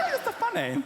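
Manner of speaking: put-on high giggling foreign-accented flirt; flirty giggle. Receptionist flirting again.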